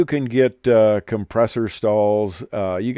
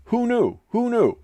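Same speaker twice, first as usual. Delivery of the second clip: high-pitched mock-innocent wonder; playful high-pitched disbelief; mock surprise; 13 semitones higher than usual. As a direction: feign surprise, voice jumping way up. Mock surprise at glider joke.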